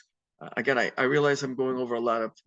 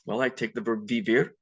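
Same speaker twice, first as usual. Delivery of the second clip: ordinary talk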